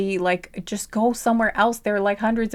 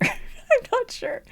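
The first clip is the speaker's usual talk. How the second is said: "I'm not sure" pitched high and stretched slow through a laugh; laughing high drawl; much slower than usual. Real, not acted. Reader to viewers, laughing high and slow. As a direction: giggle it out, high and dragging. Repeats phrase, second time drawn out.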